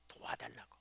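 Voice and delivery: a faint, pleading whisper. Real, not acted. Preacher whispering the believer's cry for help. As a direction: breathe the plea softly.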